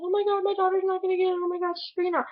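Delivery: high, fast panicked mom voice; panicking mom. Retold or imitated. Mother panicking as her daughter bombs the test, high and fast. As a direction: squeal it out like a frantic mother. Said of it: "her mom is flipping out in the background".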